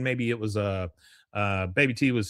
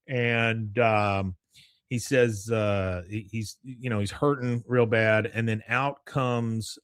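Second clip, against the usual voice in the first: usual speaking voice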